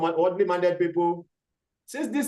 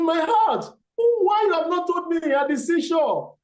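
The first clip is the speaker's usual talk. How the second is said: clever monkey; 9 semitones higher than usual. Acted. Monkey answers the crocodile.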